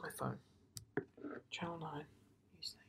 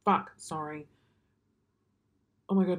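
ordinary delivery

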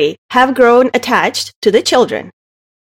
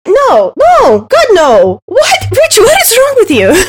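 incredulous; 8 semitones higher, 3 dB louder, slower than usual. Real, not acted. Incredulous outburst at Rich.

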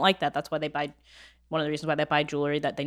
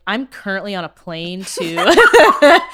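loud, slow words broken by laughter; laughing mock text; 11 dB louder, much slower than usual. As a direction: read the pretend message out while cracking up. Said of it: "I might text you tomorrow and be like".

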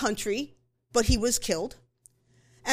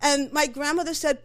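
ordinary talk

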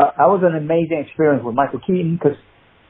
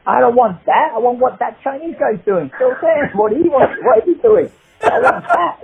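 much higher, slow insistent producer; a producer who wants the new thing; high mock-demanding "I want that"; 10 semitones higher than usual. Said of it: "he was like".

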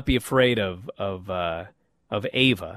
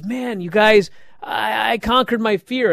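higher, bubbly "conquered" at normal volume; excited; 6 semitones higher than usual. Retold or imitated. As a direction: brag it happily to the guys. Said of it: "Thea's all excited".

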